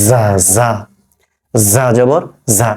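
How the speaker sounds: "জা" with a long, heavy buzzing z; slow, drawn-out z on each "জা"; correct za demo. Shown as a demonstration. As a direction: hold the z, then open into "জা". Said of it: "তালব্য স, ঝয়ের যোগফল বাংলায়".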